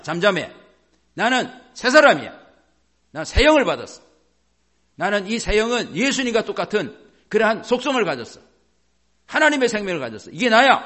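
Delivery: loud, high preaching proclamation; forceful declaration. Shown as a demonstration. Believer silencing the flesh and claiming new life, forceful proclamation. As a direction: proclaim it at full force, punching each phrase.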